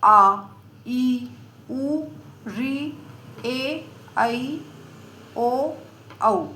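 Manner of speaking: आ ई उ री ए ऐ ओ औ each held long; long vowels drawn out. Shown as a demonstration. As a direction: stretch each vowel आ, ई, उ slowly. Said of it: "ये आठ होते हैं". (दीर्घ स्वर खींचकर)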